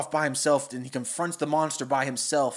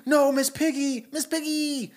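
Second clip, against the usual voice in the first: high, strained Kermit cry, much higher; 10 semitones higher than usual. Retold or imitated. Kermit calling after kidnapped Miss Piggy, high strained cry. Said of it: "Kermit's like".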